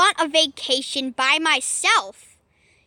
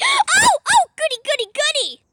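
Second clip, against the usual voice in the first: gleeful squeal; 11 semitones higher, 4 dB louder than usual. Acted. Poplio overjoyed.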